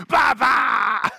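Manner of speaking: little granddaughter's loud, high growling shout; growling little girl. Retold or imitated. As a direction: roar it out like an excited little girl. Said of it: "she gets this growl in her voice".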